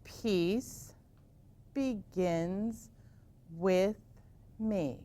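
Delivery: each word drawn out slowly; slow mantra. Shown as a demonstration. Teacher showing the class the slow finger mantra. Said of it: "So we'll try it very slowly".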